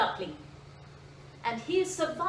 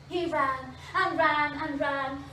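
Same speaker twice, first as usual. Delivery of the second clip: plain reading voice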